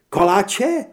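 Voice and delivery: "koláče?" high and loud with a rising question tune; thrilled question. Acted. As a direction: gasp out "koláče?" in delight. Heather thrilled about sweets.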